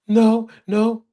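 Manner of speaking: mock tearful child's denial; crying child. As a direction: answer through tears like the son. Crying son answers dad.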